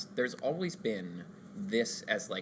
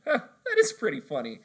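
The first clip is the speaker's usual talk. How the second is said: higher, louder, laughing through it; amused customer; 9 semitones higher, 6 dB louder than usual. Retold or imitated. The customer in the SpongeBob bit, laughing along. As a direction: chuckle it out, won over. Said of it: "the guy just is like".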